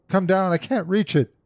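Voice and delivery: high-pitched, strained call for help; strained cry. A narrator's reading. Wife begs her husband to come down, strained cry. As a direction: call urgently, straining to reach. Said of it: "strained and panting".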